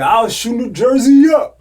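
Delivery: very loud, high hyped-up yell. Retold or imitated. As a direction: bellow it like a rapper's boast.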